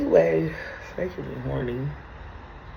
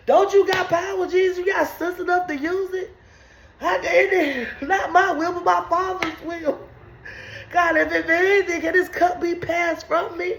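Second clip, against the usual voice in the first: mock Jesus praying; 7 semitones higher than usual.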